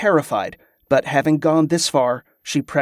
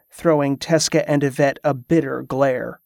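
ordinary delivery

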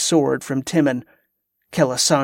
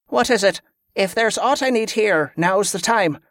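higher-pitched commander demanding an answer; lightly voiced commander, a touch impatient; 6 semitones higher than usual. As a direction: press the officer to speak up. Commander presses hesitant officer.

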